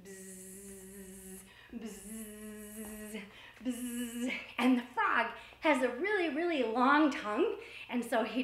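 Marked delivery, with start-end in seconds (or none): 0.0-4.3 soft low buzzing like a fly; imitated fly buzz. Shown as a demonstration. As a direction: buzz quietly like a zooming fly. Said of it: "a fly that was zooming around".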